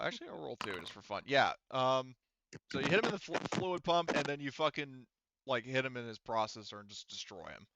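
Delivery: normal talking voice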